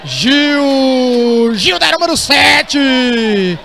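drawn-out shouted goal call. Real, not acted. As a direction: stretch the scorer's name, shouting. Goal called in live commentary.

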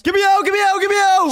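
high, loud repeated yell; shouting. Real, not acted. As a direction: yell it out over and over. Racing game, teammate calling out.

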